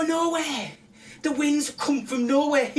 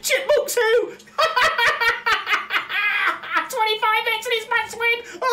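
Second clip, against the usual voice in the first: hysterical laughing; 7 semitones higher than usual. Real, not acted. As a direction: scream with joy through laughter. Hysterical over a max win.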